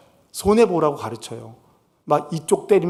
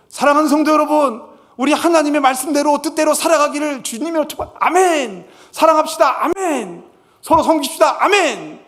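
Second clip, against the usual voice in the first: much higher, ringing preacher voice; hammed-up pulpit blessing; mock sermon climax with amens; preacher benediction; 10 semitones higher, 3 dB louder than usual. Mimicking a preacher's closing benediction.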